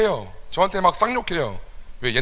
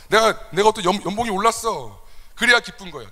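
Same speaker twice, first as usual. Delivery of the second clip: usual speaking voice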